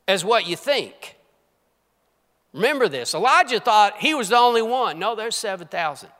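high, loud fervent preaching; preaching at full force. Real, not acted. A preacher rallying the congregation, loud and fervent. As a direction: drive it home to the congregation.

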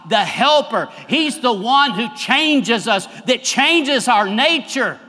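loud, high, slow preaching; full-force preaching. Acted. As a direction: drive each phrase home from the pulpit.

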